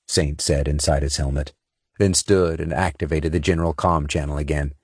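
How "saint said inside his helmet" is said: usual speaking voice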